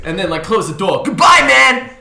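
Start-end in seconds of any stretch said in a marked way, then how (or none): 1.0-1.9 much higher, loud, hammed-up shouting bro; exaggerated bro customer. Driver mocking the unbothered customer, loud hammed-up bro voice. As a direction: ham up an over-cheerful dude. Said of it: "completely deadpan".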